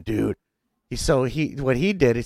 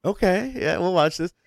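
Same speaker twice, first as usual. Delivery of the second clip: quoting himself; 8 semitones higher than usual. Retold or imitated. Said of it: "I'm like".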